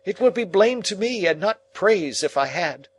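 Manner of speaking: angry tirade. Acted. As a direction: berate him and send him off.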